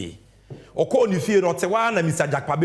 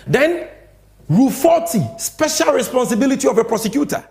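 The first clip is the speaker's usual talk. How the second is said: emphatic announcement; 7 semitones higher, 6 dB louder than usual. Real, not acted. Host reads rule emphatically.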